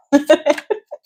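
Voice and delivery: short, high chuckle; amused laugh. Real, not acted. Reader joking about hoping the noise stops, amused chuckle. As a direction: let out a brief, high laugh. Laughing at own hope for quiet.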